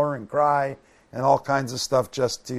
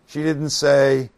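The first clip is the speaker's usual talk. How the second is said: ordinary talk, nothing marked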